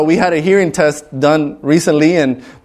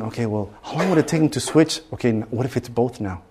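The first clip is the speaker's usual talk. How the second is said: hushed scheming; 4 semitones lower, 9 dB quieter than usual. Retold or imitated. Scheming guesses during hearing test.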